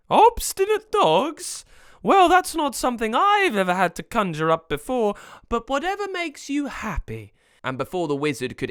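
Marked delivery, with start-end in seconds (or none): 0.1-7.3 very high sprite voice, loud then easing; obliging sugar sprite. Acted. Sprite mishearing chocolate logs as dogs, high puzzled compliance. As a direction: query it high, then give in.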